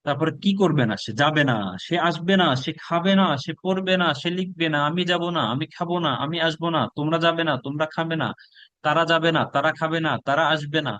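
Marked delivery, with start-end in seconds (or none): none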